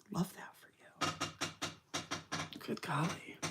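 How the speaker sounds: breathy, sly whisper of fake approval; whispered sarcasm. Real, not acted. Whispering a snide aside to the viewers.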